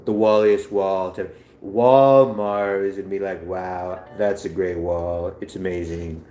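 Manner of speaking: slow, drawn-out bragging politician; boastful politician impression. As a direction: boast about it, stretching key words. Acted politician impression about a wall.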